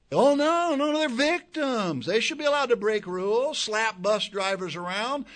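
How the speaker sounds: high, loud sneering mock voice; mock the officials' excuse with scorn; sarcastic high-pitched parody of the authorities; sarcastic mockery.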